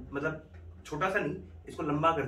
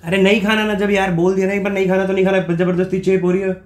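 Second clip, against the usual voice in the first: higher and louder, a put-on outburst; faked angry shout; 6 semitones higher, 10 dB louder than usual. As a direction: yell her off as a prank, acting furious. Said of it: "गुस्सा किस बात का हो रहे हो?".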